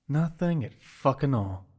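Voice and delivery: usual speaking voice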